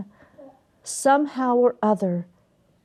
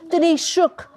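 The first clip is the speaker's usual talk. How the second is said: ordinary delivery, nothing marked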